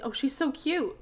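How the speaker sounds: excited gushing. Real, not acted. Player sees the character's new look, excited gushing. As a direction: gush over something adorable.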